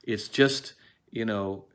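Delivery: normal talking voice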